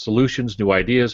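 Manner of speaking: normal talking voice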